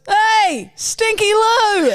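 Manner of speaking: high, drawn-out mock catcall; retold yell. Boy running past yells a nickname, mock holler. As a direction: holler it like a passer-by. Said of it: "I've had people yell out Stinky Lou".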